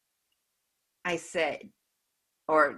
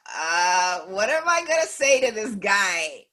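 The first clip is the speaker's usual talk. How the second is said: reluctant dismay; 3 semitones higher, 3 dB louder than usual. Retold or imitated. Thinking about what to say to this guy, reluctant dismay. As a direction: balk at the task. Retold thought before approaching the man.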